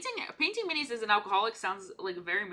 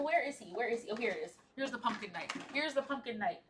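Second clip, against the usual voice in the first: normal talking voice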